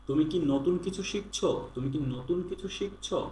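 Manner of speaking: usual speaking voice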